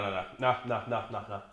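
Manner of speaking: ordinary talk, nothing marked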